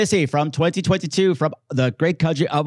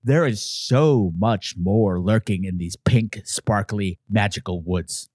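much lower, slow and hushed at the end; ominous teaser; 9 semitones lower, much slower than usual. Acted. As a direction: sink low as if secrets lurk in the woods.

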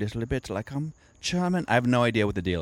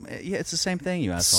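mock sarcastic retort; 7 semitones higher, faster than usual. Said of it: "I'm like".

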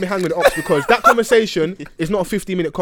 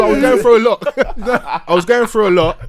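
laughing it out, louder and higher; cracking up mid-confession; 5 semitones higher, 6 dB louder than usual. Real, not acted. Laughing at his own story.

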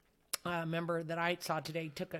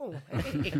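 a mock sassy, laughing "Oh, hey"; 4 dB louder than usual. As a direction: toss it off with a cheeky laugh. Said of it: "my little mouth gets all sassy".

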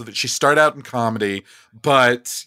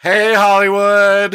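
slow, loud diva greeting; showy greeting; 7 semitones higher, 6 dB louder, slower than usual. Acted. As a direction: make a grand entrance. Feeder's showy entrance.

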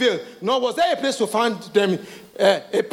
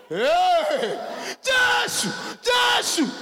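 high, startled cry of Jesus; mock startled shriek, much higher; 7 semitones higher, much slower than usual. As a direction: yelp it high like a sudden fright. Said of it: "you will shout".

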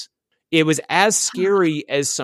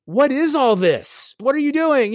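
higher-pitched exasperated questioning; public freak-out; 8 semitones higher than usual. Retold or imitated. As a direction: retell a freak-out, pitch up, volume in check. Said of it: "a public freak out".

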